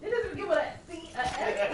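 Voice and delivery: high, loud character voice; mock correcting. I-spy player correcting the other, high loud mock protest. Acted guessing-game argument.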